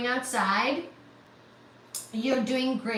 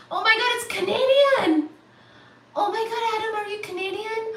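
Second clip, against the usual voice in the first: high delighted disbelief; real happy surprise; surprised; 8 semitones higher, 3 dB louder, faster than usual. Real, not acted. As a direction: gasp it out, thrilled. Discovering donor is Canadian.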